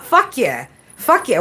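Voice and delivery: normal talking voice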